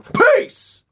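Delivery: very high yelled sign-off; sudden shout. Real, not acted. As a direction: yell it to startle. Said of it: "Did I scare you?".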